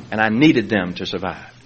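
normal talking voice

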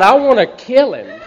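loud, high angry shout with a laugh in it; laughing angry yell; furious. Retold or imitated. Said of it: "I'm so mad".

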